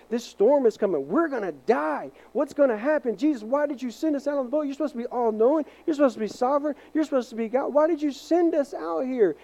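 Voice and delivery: hammed-up fear of dying at sea; panicked disciples. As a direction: fishermen freaking out in the storm. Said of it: "they start freaking out".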